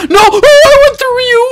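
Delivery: very high, loud wail; dismayed shout. Real, not acted. Gamer falling through the child, wailing in dismay. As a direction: shout it out high as it all goes wrong.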